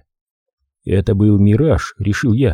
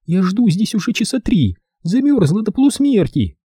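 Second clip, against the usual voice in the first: high, lightly voiced young woman; girl's voice; 11 semitones higher than usual. A narrator's reading. Freezing girl complains to Holman, lightly plaintive. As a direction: complain that you are frozen.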